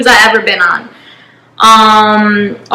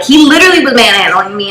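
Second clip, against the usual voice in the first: ordinary talk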